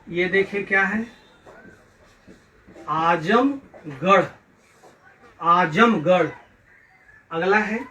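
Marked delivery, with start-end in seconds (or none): 2.9-6.3 आजमगढ़ slowly in parts, आजम-गढ़; careful pronunciation. Shown as a demonstration. Teacher modelling आजमगढ़ for pupils, slow careful pronunciation. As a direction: stretch आजमगढ़ with a long opening आ. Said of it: "इन शब्दों को शुद्ध उच्चारण कीजिए".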